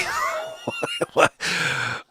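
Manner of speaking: high squealing laugh; burst of laughter. Real, not acted. Breaking up laughing at his own impression. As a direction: crack up suddenly mid-joke. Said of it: "I'm in a funny mood".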